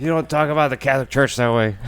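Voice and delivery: quick, high mock scolding; mildly hammed-up heckler protest; imitated offended heckler. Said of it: "That was the last thing he said".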